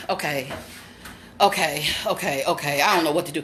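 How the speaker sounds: low, subdued dithering; nervous self-steadying. Real, not acted. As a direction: murmur it low while stalling. Bracing herself, at a loss.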